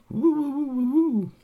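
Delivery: very high fluttering "woo" snore; alien snore. Acted. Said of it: "moving slightly as he snored".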